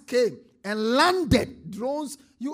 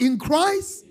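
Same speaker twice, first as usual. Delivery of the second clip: loud, raised-pitch proclamation; shouted declaration; 6 semitones higher, 8 dB louder than usual. Shown as a demonstration. Preacher has congregation shout aloud, loud and rousing. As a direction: shout it out for all to echo. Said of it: "Shout it aloud".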